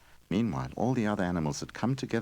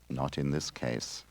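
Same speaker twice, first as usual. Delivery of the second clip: quiet tree; 4 semitones lower, 7 dB quieter, slower than usual. A narrator's reading. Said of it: "said the tree".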